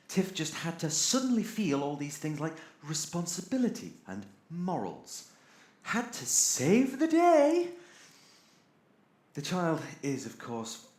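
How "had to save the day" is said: high, loud and drawn-out mockery; scornful sing-song, slow and high; sarcastic mock heroics. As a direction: sneer it out slowly like a mock hero.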